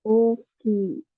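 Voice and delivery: oki with both O and I drawn out; long vowels in oki. Shown as a demonstration. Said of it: "bacanya panjang gitu ya".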